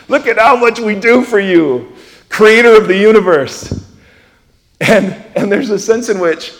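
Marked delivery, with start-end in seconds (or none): 0.1-3.8 smug self-righteous boast. Pretend. Self-righteous boast mocked to God.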